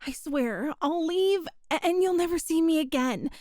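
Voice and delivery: ordinary delivery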